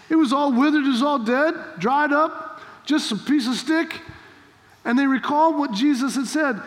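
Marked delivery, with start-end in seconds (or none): none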